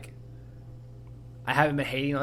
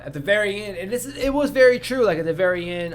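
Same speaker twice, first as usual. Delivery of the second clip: worked-up rant; 7 semitones higher, 6 dB louder than usual. Real, not acted. Heated rant about Oppenheimer.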